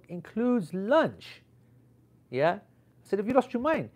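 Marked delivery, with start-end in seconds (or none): 0.1-1.4 high, very drawn-out disbelief; incredulous echo. Retold or imitated. His incredulous echo of organizer. 3.2-3.9 much higher, acted incredulity; retold protest with a big leap in pitch; outraged disbelief. Retold outraged reply to organizer.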